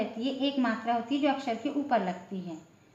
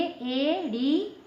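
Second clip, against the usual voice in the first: एड़ी drawn out slowly with a rise; careful model word एड़ी for pupils; slow demo; much slower than usual. Shown as a demonstration. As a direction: stretch एड़ी like eeड़ी. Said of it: "यानी उच्चारण देखने के लिए".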